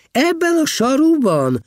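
high, loud-edged outraged question; indignant. A narrator's reading. Heribald to the abbot about his sandals, indignant. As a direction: protest in high-pitched disbelief. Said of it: "méltatlankodott Heribald".

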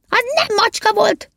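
very high, affronted squeal; indignant denial. A narrator's reading. Tramini denying he threw a cat, indignant high protest. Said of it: "kérte ki magának Tramini".

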